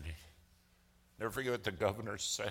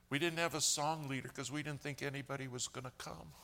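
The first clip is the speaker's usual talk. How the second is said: higher and higher, building to a passionate climax; rising preaching fervor; 7 semitones higher than usual. Acted. Preacher telling the congregation about the buses, rising fervor. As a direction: let the excitement swell into full preaching.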